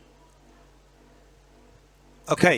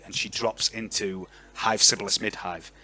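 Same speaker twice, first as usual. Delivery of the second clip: normal talking voice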